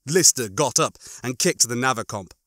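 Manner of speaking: ordinary delivery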